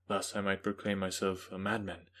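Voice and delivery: ordinary delivery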